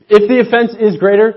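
if punched high and loud; emphatic restatement. Real, not acted. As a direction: lift the start of it hard. Preacher restates the principle emphatically.

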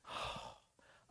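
long unvoiced sigh of frustration; weary sigh. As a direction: let out a tired sigh. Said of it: "he's going".

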